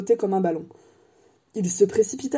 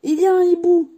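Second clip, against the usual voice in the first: loud, high urgent cry; 10 semitones higher, 6 dB louder than usual. A narrator's reading. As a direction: shout the warning. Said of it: "s'écria Harry".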